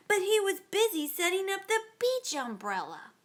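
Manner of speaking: usual speaking voice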